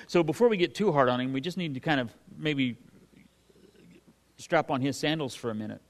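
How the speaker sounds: ordinary talk, nothing marked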